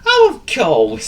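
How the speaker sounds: high, loud, slow realisation; drawn-out cartoon dog exclamation; forgetful dog. A narrator's reading. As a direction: exclaim as if suddenly remembering. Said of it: "said Willie".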